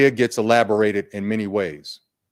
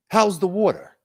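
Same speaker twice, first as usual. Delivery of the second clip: much higher fox voice, casual question; fox asking; 10 semitones higher than usual. Acted. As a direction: toss the question lightly like the fox. Fox asks fish about water.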